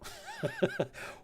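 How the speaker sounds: high, soft giggle; stifled high laughter. Real, not acted. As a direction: break into a small high giggle. Cracks up while composing reply.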